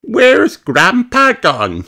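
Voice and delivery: put-on very high, slow puzzled child; Peppa's voice. Peppa asking Granny where Grandpa went, puzzled. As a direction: ask like a worried little girl. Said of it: "says Peppa".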